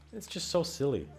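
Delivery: ordinary delivery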